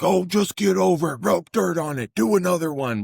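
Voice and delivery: high, brushing-off gym coach; mock old-school coach. Coach mimicking a dismissive old-school trainer: high, mocking. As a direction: toss it off like a coach who doesn't care.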